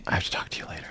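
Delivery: hushed, voiceless whisper; nervous in-character whisper. Acted. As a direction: whisper it like a secret request. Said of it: "you don't have to whisper".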